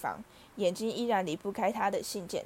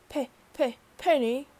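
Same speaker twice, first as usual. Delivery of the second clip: stammering, gasping out the name, getting louder; 4 semitones higher, 6 dB louder than usual. A narrator's reading. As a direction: choke on the name in shock. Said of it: "他喘着气说". (喘着气结巴地喊佩妮)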